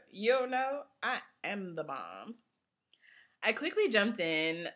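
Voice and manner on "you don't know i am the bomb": cocky friend's comeback. Acted. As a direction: brag it, stretching out the punchline. Friend's imagined cocky comeback acted out.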